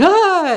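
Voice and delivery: loud, high mock yelp of alarm; alarmed butterfly cry. Storyteller voicing a butterfly sensing danger, alarmed cry. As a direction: let out a sudden high, loud "Ah!".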